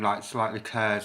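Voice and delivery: ordinary talk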